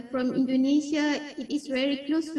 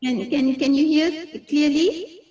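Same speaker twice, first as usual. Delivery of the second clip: louder sound check, rising question; speaking up; 2 semitones higher, 6 dB louder than usual. Real, not acted. Presenter checking with host that she is audible, speaking up. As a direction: raise your voice to check they hear. Speaking up after volume request.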